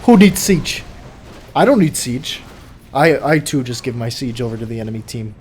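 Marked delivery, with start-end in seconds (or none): 0.0-2.4 loud mocking rhetorical question; sarcastic. Real, not acted. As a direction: throw it out with heavy sarcasm. Sarcastic rhetorical question, repeated later.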